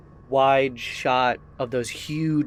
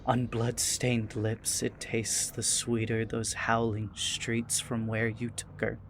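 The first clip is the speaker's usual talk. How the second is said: softer, measured recital of dark verse; quiet, voiced near-whisper; hushed incantation; 6 dB quieter than usual. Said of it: "he whispers out".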